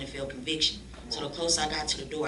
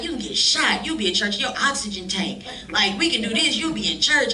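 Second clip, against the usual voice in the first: loud teasing jeer, pitched up; mock shouting; 6 semitones higher, 8 dB louder than usual. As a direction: razz her loudly for always going to church. Mocking friend going to church.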